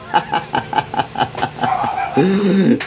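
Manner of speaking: long bubbling laugh. Real, not acted. Amused by the tired pet, a long rippling laugh. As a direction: burst into a run of chuckles.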